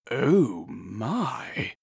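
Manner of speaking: affected aristocratic lisp; lisping marquis. A narrator's reading. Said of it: "lisped the Marquis".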